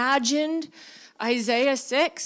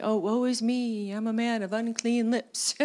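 mock-calm, even reading of the verse; 3 semitones lower than usual. Shown as a demonstration. Preacher mocking how the church reads Isaiah, calm and even. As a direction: read it placid, as if nothing happened. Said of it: "we read the passage very calmly".

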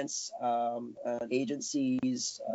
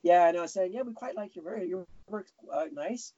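put-on polite British accent, pitched up and understated; light UK accent; 5 semitones higher than usual. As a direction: put on a gentle, wobbly UK accent. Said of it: "was that a little accent I heard".

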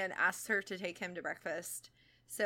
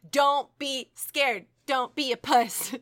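emphatic mock rule; 5 semitones higher, 11 dB louder, slower than usual.

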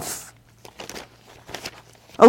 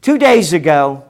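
ordinary talk, nothing marked

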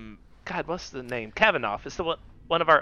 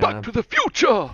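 excited exclaim; 7 semitones higher, 3 dB louder than usual. Real, not acted. Joke movie title call.

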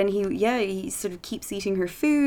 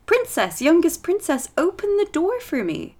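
higher squeaky froggy plea at the door; high frog call; 9 semitones higher than usual. Acted. Frog begging the princess, high squeaky call.

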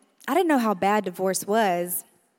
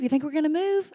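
put-on higher kid's worried question; child asking; 7 semitones higher than usual. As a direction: voice the little brother. Kids guessing, voiced child.

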